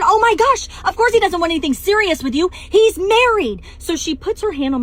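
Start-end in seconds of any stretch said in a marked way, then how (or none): 0.0-0.7 much higher, louder shocked outburst. Retold or imitated. Realizes man is her husband. 0.9-2.5 higher and louder, "he" rising in disbelief; loud high mocking disbelief; shocked. Realizes man is her husband. 2.5-3.6 much higher, louder shocked outburst. Retold or imitated. Realizes man is her husband.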